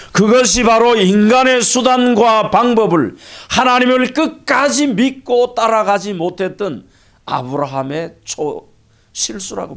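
loud, high pulpit cry; forceful preaching. Real, not acted. Pastor lamenting the fallout of Abraham's mistake, forceful preaching. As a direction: raise the voice high and drive every phrase home.

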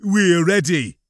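loud, commanding officer impression; Drelfin. A narrator's reading. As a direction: state it forcefully, posing as the major. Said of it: "his best impression of Drelfin's voice".

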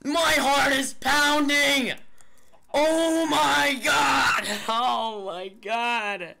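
high, crying-out victory yell; ecstatic victory shout. Real, not acted. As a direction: scream with relief and joy. Winning the fight.

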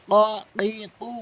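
tongue root against the palate for each of "ক, কি, কু"; qaf articulation. Shown as a demonstration. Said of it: "জিহ্বার গোড়া উপরে তালু থেকে উচ্চারণ হয়". ("ক, কি, কু" জিহ্বার গোড়া থেকে)

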